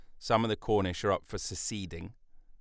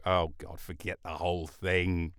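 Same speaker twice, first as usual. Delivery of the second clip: normal talking voice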